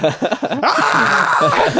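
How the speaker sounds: high, laughing mock scream of a scared kid; child's scream. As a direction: squeal like a terrified five-year-old, laughing through it. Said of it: "you start screaming".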